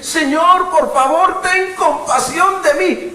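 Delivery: high, loud desperate plea to God; desperate cry. Pretend. Preacher showing a believer how to cry out, desperate loud plea. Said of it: "comenzar a gritar desesperadamente".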